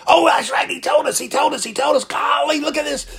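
high, quick voiced delight of the disciples; thrilled sudden recall; excited remembering. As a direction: burst out as it suddenly comes back. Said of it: "something you've forgotten come back into mind".

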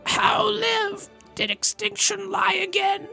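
very high, crying guest voice; high squeaky voice. Acted. Guest lines in put-on voice.

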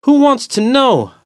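high, loud challenge; annoyed. Acted. Walker challenges the stranger back, annoyed. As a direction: throw the question back at him. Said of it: "annoyed by his voice and attitude".

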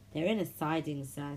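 ordinary delivery, nothing marked

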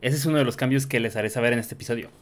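ordinary talk, nothing marked

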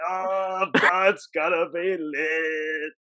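slow, drawn-out stoner voice; Jonah. Retold or imitated. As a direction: imitate a stoned dude telling news. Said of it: "You sound like you're high".

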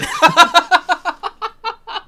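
loud high burst of laughter; big laugh. Real, not acted. Teller cracking up at the end of the Kimbo story. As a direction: crack up at your own punchline.

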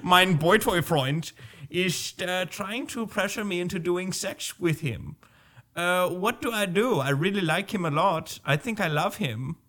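hammed-up German accent, hesitant confession; German teenager asking a pastor for advice; Hilde. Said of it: "let's just say she's German".

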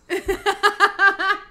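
high-pitched burst of laughter; squealing laugh at own joke. Real, not acted.

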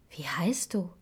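soft unvoiced whisper; whispered question. Dwarves asking the frightened girl, whispered. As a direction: ask it gently under the breath.

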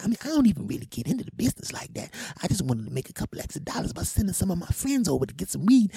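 very high, strained mimicked woman; hammed-up high-pitched female tirade; ranting changed woman's voice. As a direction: rant indignantly in a put-on high female voice. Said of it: "her voice went from this to this".

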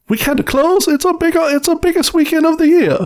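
high, excited mock Italian accent. Park owner protesting the closure, mock Italian accent. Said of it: "like, a stereotypical Italian guy".

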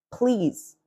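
ordinary talk

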